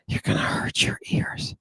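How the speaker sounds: whispered mock warning; hushed, whispery pretend warning message. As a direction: whisper it, barely any voice. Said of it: "I'm gonna lower my voice a little bit".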